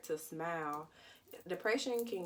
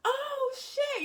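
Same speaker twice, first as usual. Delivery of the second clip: much higher, louder startled yelp; panicked shout; 8 dB louder than usual. Retold or imitated. Retelling hitting the second bridge, panicked shout. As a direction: blurt it out in sudden panic. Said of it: "I'm freaking out".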